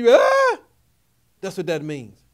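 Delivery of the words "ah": sudden high, loud startled scream; scared outcry. Shown as a demonstration. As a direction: cry aloud as if suddenly frightened. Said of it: "you get so scared".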